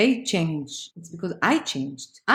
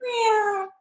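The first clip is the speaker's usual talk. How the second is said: high mock meow; a light little cat meow, much higher; 13 semitones higher than usual. Mock cat sound, calm mood.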